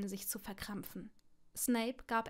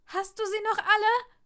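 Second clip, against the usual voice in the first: much higher and louder angry outburst; snapping shout; 10 semitones higher, 9 dB louder than usual. Acted. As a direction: explode at him. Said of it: "fuhr Longbottom ihn an".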